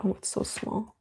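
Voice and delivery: breathy whispered comment to self; a real whispered remark to herself; whispered aside. Real, not acted. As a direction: mutter it under your breath. Aside about tiny screen text.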